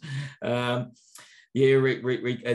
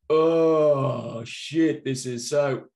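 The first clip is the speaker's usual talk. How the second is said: put-on bratty kid complaint; 2 semitones higher, 7 dB louder, much slower than usual.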